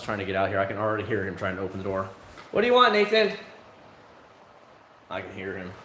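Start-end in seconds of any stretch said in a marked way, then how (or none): 2.5-3.3 calling to son. Real, not acted. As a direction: call out to your son through the door. Calls to son at the door.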